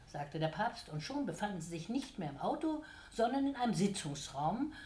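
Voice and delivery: ordinary delivery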